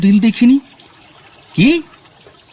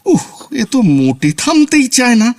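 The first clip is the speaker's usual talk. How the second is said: exasperated; 7 semitones higher than usual. Acted. Exasperated at the talkative maid.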